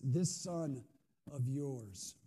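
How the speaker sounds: "this" punched with scorn; stress on this. Acted. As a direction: hit "this" to disown him. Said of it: "he's not even calling him his brother".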